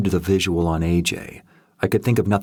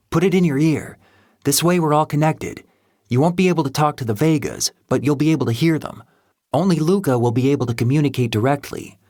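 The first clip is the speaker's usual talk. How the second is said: higher, fast, matter-of-fact briefing; brisk techie voice; 7 semitones higher, faster than usual. A narrator's reading. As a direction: rattle off the instructions quickly. Happy explains the earpieces.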